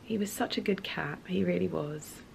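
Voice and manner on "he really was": soft whispered tribute; tender hushed whisper. Real, not acted. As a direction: whisper fondly. Tender memory of cat.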